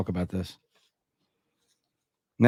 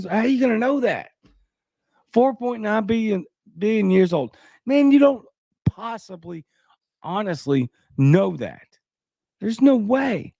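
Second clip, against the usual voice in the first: pitched way up, fast, can't believe it; incredulous; 9 semitones higher than usual. Real, not acted. Disbelieving the study's claim.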